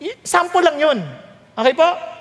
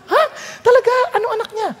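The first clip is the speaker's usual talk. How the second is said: shocked disbelief; 10 semitones higher, much faster than usual. Retold or imitated. Friend hears the car gave birth.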